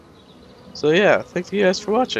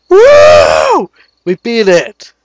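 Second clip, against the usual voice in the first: loud whoop of triumph; victory cheer; 4 semitones higher, 10 dB louder, much slower than usual. Real, not acted. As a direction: cheer out, we won. Cheering after winning the fight.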